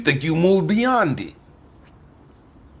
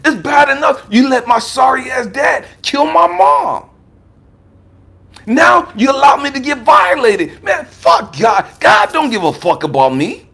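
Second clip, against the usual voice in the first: put-on angry kid yelling; 7 semitones higher than usual. A boy yelling his rage at God. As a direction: shout it up high like a hurt six-year-old. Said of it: "This was my attitude at six years of age".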